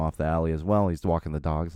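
ordinary talk, nothing marked

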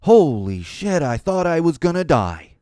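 slow, drawn-out mock horror; a hammed-up social media complaint; mock dramatic post. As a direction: act out a panicked poster. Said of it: "going on social platforms and saying".

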